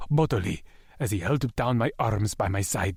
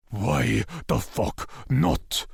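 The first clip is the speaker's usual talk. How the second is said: slow defiant demand; much slower than usual. A narrator's reading.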